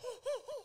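high, quiet giggle; soft laugh. Real, not acted. Breaks into laughter mid-discussion.

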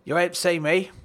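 dad dodging his wife's phone call; quick denial. Acted. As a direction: blurt it fast to dodge the call. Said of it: "said my dad quickly".

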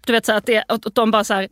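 ordinary delivery

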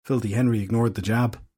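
plain reading voice

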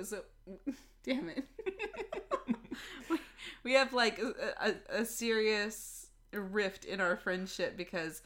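usual speaking voice

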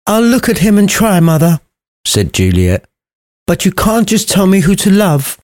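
put-on high, posh young lady voice; prissy girl answering back; posh Juliet.

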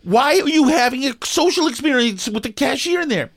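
frustrated driver urging the woman ahead to hurry; exasperated inner rant. Retold or imitated. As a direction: urge her to just go, exasperated. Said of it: "And I'm like".

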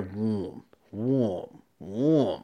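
slow falling "wum" engine noise; imitated engine cranking. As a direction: mimic a motor labouring to turn over.

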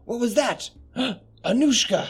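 high-pitched gasp and cry out; startled alarm. Acted. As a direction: react in alarm to a sudden scream. Startled reaction to a scream.

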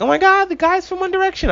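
put-on high, swooning teen fan squeal; breathless boy band fangirl; gushing fangirl. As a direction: gush like a starstruck teenage girl. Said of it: "But they're still like".

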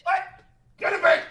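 loud high barking yell; shouting over telly. Acted. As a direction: bark orders at the kids. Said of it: "You were literally barking then".

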